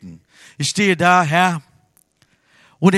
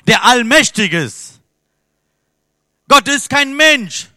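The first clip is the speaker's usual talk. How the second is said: full-force preaching; 4 semitones higher, 6 dB louder than usual. Acted. Preacher urging the congregation to expect miracles, full-force. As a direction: preach it out over the whole room.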